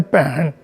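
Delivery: पहन said with a deep, low Punjabi tone. Shown as a demonstration. Lecturer showing students how Central Punjab says sister, deep tone. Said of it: "पहन जो है उसमें एक deep tone लगती है".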